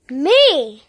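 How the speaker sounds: loud, eager shout of me; a loud one-word volunteer. Real, not acted. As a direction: yell it out to volunteer. Volunteering to run into it.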